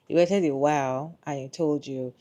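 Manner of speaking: ordinary talk, nothing marked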